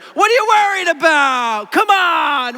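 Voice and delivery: loud, high, drawn-out mock holler; hammed-up dismissive uncle outburst; exasperated uncle. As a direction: play the tactless uncle scoffing at worry. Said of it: "your mom just told your uncle".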